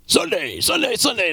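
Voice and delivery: rising monster truck hype call. Acted. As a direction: belt the Sundays like a truck rally ad. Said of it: "my monster truck voice".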